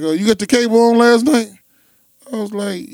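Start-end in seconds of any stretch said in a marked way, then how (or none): 0.0-1.5 high, loud mock interrogation; pressing retold cop; cop questioning. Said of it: "He was like".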